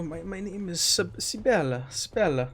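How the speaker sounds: soft, dazed, half out of it; a touch of a confused, weak prisoner; disoriented. Acted. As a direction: drift through the name as if barely there. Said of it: "seems really kinda disoriented and out of it".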